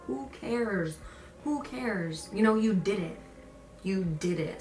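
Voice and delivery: usual speaking voice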